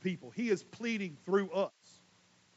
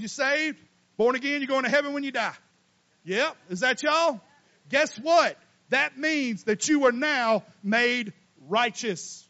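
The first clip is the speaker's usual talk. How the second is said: louder, higher, stretched-out preaching; preaching at full force; 5 semitones higher, 7 dB louder than usual. Acted. Preacher rallying the church about salvation, full force. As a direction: drive it home to the congregation.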